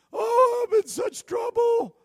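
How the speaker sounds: high, loud wailing voice; distressed man. Retold or imitated. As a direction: wail it like a desperate man.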